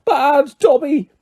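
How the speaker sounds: high shrieked self-scolding, the second slow; shouting elf. A narrator's reading. Elf punishing himself at the window, shouting. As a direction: scold yourself while banging your head.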